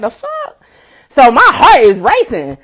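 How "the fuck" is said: very high, softer squeak of disbelief; mock-baffled squeak; retold bewilderment. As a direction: reenact being totally baffled, way up high. Retold shock at being led away.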